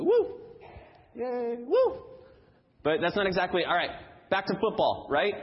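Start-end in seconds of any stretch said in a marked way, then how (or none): 0.0-2.0 high whooping cheer; mock cheering. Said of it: "we're supposed to go".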